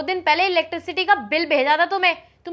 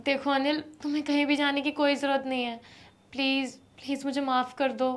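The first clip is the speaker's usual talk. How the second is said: softer, lower tearful plea; wife begs husband not to walk out; pleading softly; 4 semitones lower, 9 dB quieter than usual. Acted. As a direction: beg him not to leave. Wife begs him to stay.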